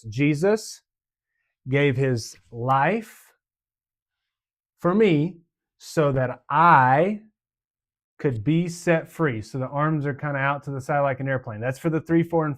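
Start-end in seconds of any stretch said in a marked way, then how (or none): 0.0-9.4 slow, spaced out, each phrase set apart; phrase by phrase, leaving pauses for the hand motions. Shown as a demonstration. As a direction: teach it slowly, pausing for each motion. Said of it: "And it goes like this".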